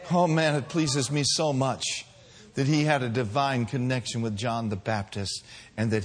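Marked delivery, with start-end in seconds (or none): none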